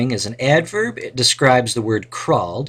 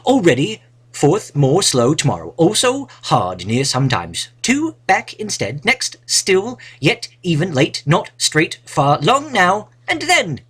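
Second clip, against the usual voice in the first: reading the words in a higher, put-on British accent; 6 semitones higher than usual. Teacher reads the adverb list aloud in a put-on British accent. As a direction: play the posh British reader for the class. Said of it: "Perhaps with a British accent".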